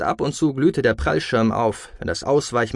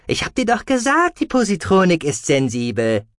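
high, reproachful creature voice; Gucky; 7 semitones higher, 3 dB louder than usual. A narrator's reading. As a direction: start very high, scolding, then come down. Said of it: "meinte Guki". (hoch einsetzend, belehrend)

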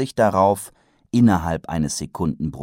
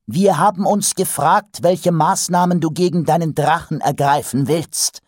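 high and louder, a ruler's proclamation; loud announcement; 12 semitones higher, 6 dB louder than usual. A narrator's reading. Vetinari loudly announces to the council, high and louder. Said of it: "verkündete Lord Vetinari laut".

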